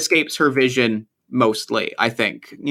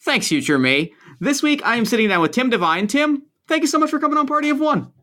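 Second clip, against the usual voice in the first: excited; 9 semitones higher than usual. Real, not acted. Enthusiastic podcast host greeting guest.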